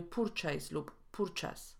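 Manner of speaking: purchase with u and r, ending -ejs, then -es; wrong purchase. Shown as a demonstration. Teacher showing two mistakes, purchase with Polish u and r. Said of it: "a nie".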